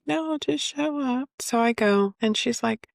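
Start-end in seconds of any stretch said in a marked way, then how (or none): 0.1-1.3 higher, slow offhand lady's voice; lightly mimicking the therapist's casual reply; imitating the lady. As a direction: toss it off like a breezy receptionist.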